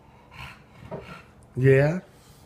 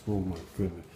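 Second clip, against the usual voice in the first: under the breath, much softer and lower; quiet, low disbelief; 8 semitones lower, 12 dB quieter, faster than usual. Real, not acted. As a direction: sigh it out in disbelief. Exasperated at dog again.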